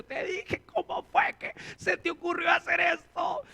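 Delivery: high mock scolding shout; scolding parent. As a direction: scold the child like an exasperated parent.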